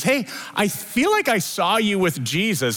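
a hint of a girl's nosy accusation; light mock servant girl; curious servant girl. Second servant girl accuses Peter.

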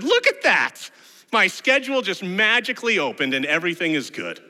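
high, loud burst of delight; hammed-up sudden eagerness; mock excitement. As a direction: exclaim as if amazed by your own luck. Acted sudden eagerness at game tickets.

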